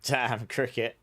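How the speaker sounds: usual speaking voice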